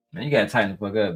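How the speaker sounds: normal talking voice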